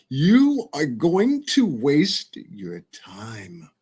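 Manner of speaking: drawn-out, each word spaced; slow taunting warning; slow, deliberate warning. Real, not acted. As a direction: spell it out slowly to the haters.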